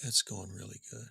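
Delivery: low, very soft, no energy; barely-there reply to the radio host. Retold or imitated. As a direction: mumble it like you'd rather not be interviewed. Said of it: "my impersonation of, of [name]".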